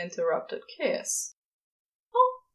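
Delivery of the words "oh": high, slow and tender, with a sigh in it; a fond angel, slow and sighing; sighing darling. Acted. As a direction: sigh the endearment lovingly. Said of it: "he sighs".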